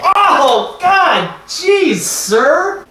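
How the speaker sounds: excited shouting. Acted. Clip montage, excited shouting.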